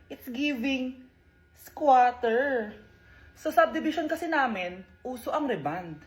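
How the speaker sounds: quiet condescending taunts; a spoiled classmate sneering softly; snobby mean girl. Acted. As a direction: look down on the new girl. Mean girl bullying new student.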